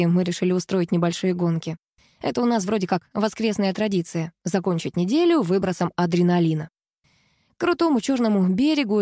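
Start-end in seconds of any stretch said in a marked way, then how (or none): none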